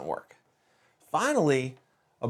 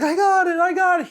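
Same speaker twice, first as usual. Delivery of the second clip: high, loud imitation of a thrilled kid; triumphant shout, voice way up high; pumped-up excitement; 7 dB louder than usual. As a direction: crow it like an excited boy. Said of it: "He's so excited, he's so pumped".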